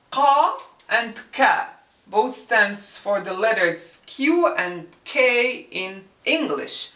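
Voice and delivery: ordinary talk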